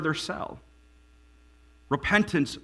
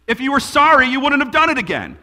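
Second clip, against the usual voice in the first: stern dad; 8 semitones higher, 7 dB louder than usual. Retold or imitated. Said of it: "my dad would look at us and he'd go".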